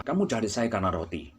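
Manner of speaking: Jesus rebuking the crowd, soft and stern. Retold or imitated. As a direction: quietly tell them the hard truth. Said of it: "Langsung berkata kepada mereka".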